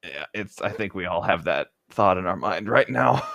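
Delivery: normal talking voice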